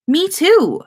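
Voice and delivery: high, loud, eager "Me too"; excited exclamation. A narrator's reading. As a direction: blurt it out with delight. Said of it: "the girl exclaimed".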